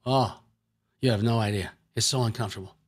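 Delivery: low, softened voice of someone hurting; sufferer's reply. Acted. Preacher playing the limping man, low lightly voiced complaint. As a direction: lightly act out being in discomfort. Acting sufferer's part in healing demo.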